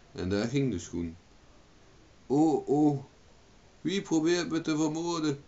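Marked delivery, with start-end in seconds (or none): 2.3-5.4 high startled outcry; alarmed cry. A narrator's reading. Margaret woken in bed by a shoe, alarmed cry. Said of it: "schreeuwde Margaret vanuit het bed".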